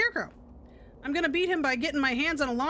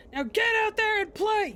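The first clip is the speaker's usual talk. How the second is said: screaming coach; 8 semitones higher than usual. Acted. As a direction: bark it like a drill coach. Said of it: "screams into the blades".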